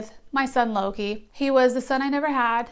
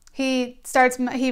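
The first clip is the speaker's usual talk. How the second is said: usual speaking voice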